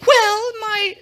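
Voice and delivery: very high falsetto lady voice; pleading queen. A narrator's reading. Queen begging the king for her life, high-pitched pleading. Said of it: "Esther replied".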